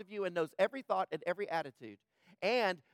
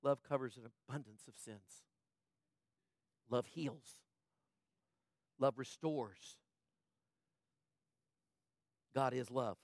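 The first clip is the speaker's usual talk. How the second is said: soft, low and slow; quiet reverent preaching; tender; 5 semitones lower, 6 dB quieter than usual. Acted. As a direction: soothe them with the word love. Preacher softens on love.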